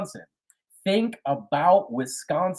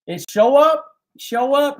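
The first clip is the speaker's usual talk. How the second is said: excited call-out; 10 semitones higher, 8 dB louder than usual. Real, not acted. Urging listeners to support locals.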